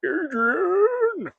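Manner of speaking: bad, very high shrieked Rocky cry for Adrian; a failed Rocky yell, pitched far too high; Stallone impression. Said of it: "Stallone goes".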